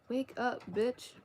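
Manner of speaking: ordinary delivery, nothing marked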